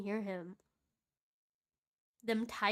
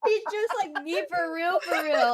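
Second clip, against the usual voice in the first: excited; 9 semitones higher, 12 dB louder than usual. Real, not acted. Excited agreement.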